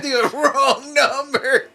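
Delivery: high, slowly stretched mock holler; laughing, drawn-out high quote; laughing imitation. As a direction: imitate the yell while cracking up. Replaying the hollered line, laughing.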